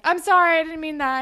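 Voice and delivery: high apology, bursting out loud; acted flustered apology. Jane apologizes to Spinner in the car, acted fluster. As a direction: imitate a friend backtracking.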